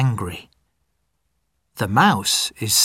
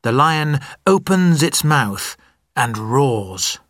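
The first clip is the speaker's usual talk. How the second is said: usual speaking voice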